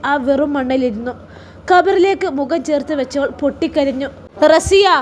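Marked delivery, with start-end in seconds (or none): 4.5-4.9 higher and louder, calling her name out; resounding call. A narrator's reading. Husband's voice calling out to grieving Rasiya, resounding and loud. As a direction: ring her name out across the graveyard. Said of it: "ഷിബിലിയുടെ മുഴക്കമുള്ള ശബ്ദം".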